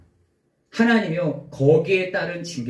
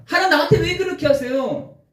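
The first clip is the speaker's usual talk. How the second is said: high anguished cry to God; 10 semitones higher than usual. Acted. Solomon crying out to God, anguished and high. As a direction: cry out to heaven, voice rising high. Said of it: "솔로몬이 하나님께 막 외치는 겁니다".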